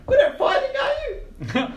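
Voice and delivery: put-on high, squeaky old gnome calling through a door; squeaky gnome behind the door. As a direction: pipe up high like a tiny old man. GM voices Quince.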